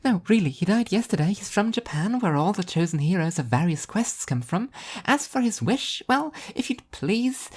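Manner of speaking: high, sing-song airy angel; bubbly and blissfully oblivious; cheery angel voice. A narrator's reading. Spaced-out angel teasing the sleepy devil, high and chirpy.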